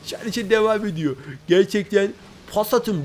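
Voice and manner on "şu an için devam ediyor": ordinary delivery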